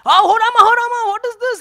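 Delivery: high, loud cry of amazement; astonished outcry. Acted. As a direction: exclaim it as if stunned by the sight. Astonished scholar exclaims in story.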